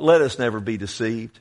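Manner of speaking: ordinary delivery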